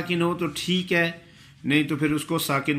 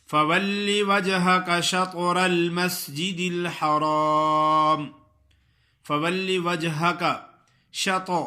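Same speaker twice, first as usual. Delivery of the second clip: Quranic recitation; 2 semitones higher than usual. Acted. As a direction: recite it melodically and unhurried. Recites verse.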